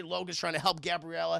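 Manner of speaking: usual speaking voice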